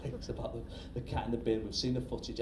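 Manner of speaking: ordinary delivery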